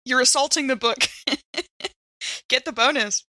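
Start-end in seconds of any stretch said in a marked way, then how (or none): none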